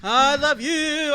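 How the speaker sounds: loud, slow, swooning declaration; mock passionate lover. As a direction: moan it like a lovestruck partner. Mocks partner's passionate declaration.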